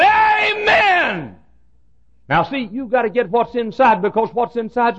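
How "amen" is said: a huge high shouted amen; preacher's booming amen; loud holler. Retold or imitated. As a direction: holler it with all you've got. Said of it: "the real me came out, and I said".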